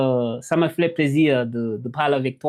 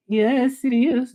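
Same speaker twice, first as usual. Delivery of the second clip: excited; 8 semitones higher, slower than usual. Real, not acted. Celebrating a viewer response.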